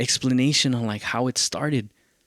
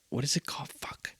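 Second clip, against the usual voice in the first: unvoiced whisper to himself; whispered aside; 4 semitones higher, 10 dB quieter than usual. Real, not acted. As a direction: whisper it while searching memory. Mutters to himself mid-story.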